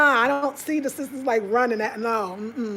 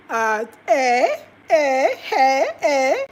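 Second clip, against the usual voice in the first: Ghanaian exclamation; 6 dB louder, much slower than usual. Retold or imitated.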